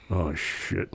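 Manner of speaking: low, hushed dismay; retold dismay. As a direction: quietly let the bad news sink in, retelling it. Retells his sinking discovery.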